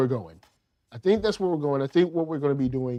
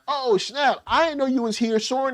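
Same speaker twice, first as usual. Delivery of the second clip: high-pitched mock astonishment; hammed-up surprised greeting; pretend happy surprise; 9 semitones higher than usual. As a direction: play a pleasantly startled buddy, pitched way up. Imagined rescued John's surprise.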